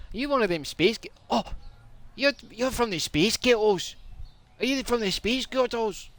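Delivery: high, squeaky put-on Scots; mock Scottish interrogation; Scottish challenge. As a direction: accuse them in a high Scottish accent. Acted Scottish quarrel.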